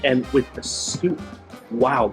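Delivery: ordinary delivery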